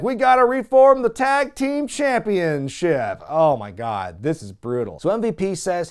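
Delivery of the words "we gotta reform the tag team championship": triumphant proclamation. Retold or imitated. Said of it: "they're finally like".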